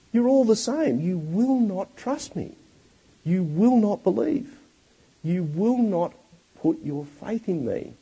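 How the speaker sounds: exasperated rebuke. Retold or imitated. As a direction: plead with the crowd, fed up, high and pressing. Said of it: "Jesus is exasperated".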